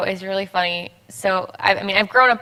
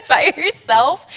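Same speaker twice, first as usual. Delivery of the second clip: laughing repeat; 6 semitones higher, 5 dB louder, slower than usual. Real, not acted. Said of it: "but if you're sobbing by yourself".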